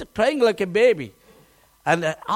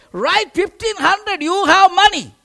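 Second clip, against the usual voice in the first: insistent tease; 8 semitones higher, 5 dB louder than usual. Retold or imitated. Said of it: "He said".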